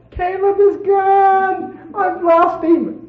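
slow, very high wail; imitating a high, drawn-out cry; mock bawling. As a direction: wail it out like a hammed-up distraught mother. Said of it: "bawling and screaming".

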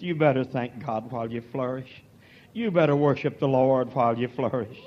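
ordinary talk, nothing marked

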